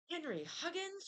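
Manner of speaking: high astonished call of his name; girl exclaiming at Henry covered in paint. A narrator's reading. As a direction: gasp his full name in surprise. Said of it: "she exclaimed".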